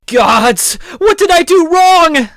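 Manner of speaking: high, very loud wail to the gods; anguished scream. Acted. Sigurd told that Gudrid passed away, anguished scream. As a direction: scream it skyward, dragging out the last words. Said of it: "And upon screaming this".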